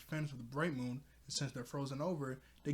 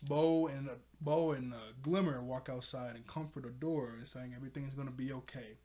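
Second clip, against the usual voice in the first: ordinary talk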